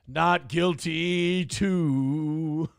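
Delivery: slow low mock voice. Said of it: "you might hear Gino say".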